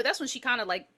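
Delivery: ordinary delivery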